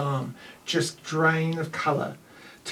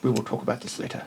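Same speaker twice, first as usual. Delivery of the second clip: low grumble; 4 semitones lower, 6 dB quieter than usual. Acted. NPC mutters a threat.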